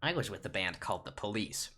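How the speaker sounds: usual speaking voice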